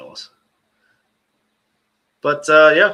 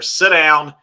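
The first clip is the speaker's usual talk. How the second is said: loud, raised mock-stern dad order; 4 semitones higher, 10 dB louder than usual. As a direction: put on a commanding dad voice. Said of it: "hear that dad voice?".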